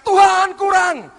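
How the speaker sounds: high, loud, slow plea; crying out. Retold or imitated. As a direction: wail it up at God. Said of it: "Engkau teriak". (seruan keras dan tinggi kepada Tuhan)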